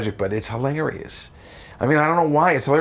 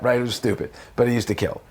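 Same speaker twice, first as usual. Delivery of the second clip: normal talking voice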